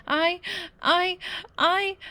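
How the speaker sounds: high, broken stammer through tears; stammering sobs. A narrator's reading. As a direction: choke on each I, close to crying.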